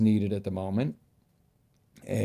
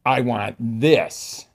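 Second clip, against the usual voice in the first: determined demand; 9 dB louder, much slower than usual. Shown as a demonstration. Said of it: "and know what you want".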